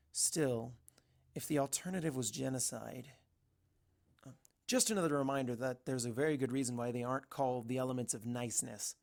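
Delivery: usual speaking voice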